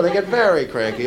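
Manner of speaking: ordinary talk, nothing marked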